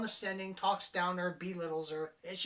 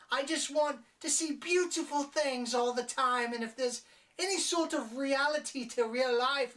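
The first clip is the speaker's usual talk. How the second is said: much higher, louder whiny mock-girl voice; mopey pushover; 7 semitones higher, 4 dB louder than usual. Reviewer parodying the sheltered heroine, whiny and high. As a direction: whine it like a sheltered child. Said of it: "Always talking like this".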